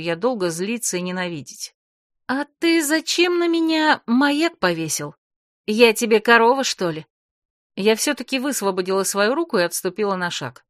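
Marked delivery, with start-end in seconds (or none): 2.3-7.1 indignant. Acted. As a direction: demand an answer with indignation. Heroine confronts him indignantly.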